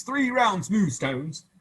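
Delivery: a showman's slow request; slow and grand. Acted. Soldiers asking for stones.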